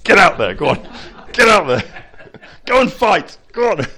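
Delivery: loud, high barked orders, laughing; hammed-up general shoving men to battle; mock Cromwell rallying troops. Cromwell driving his troops out to fight, loud mock orders. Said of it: "And he's going".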